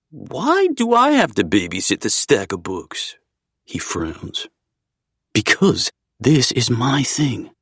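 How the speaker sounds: playful friend voice. A narrator's reading. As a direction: tease him with a grin. Zev frowns, complains.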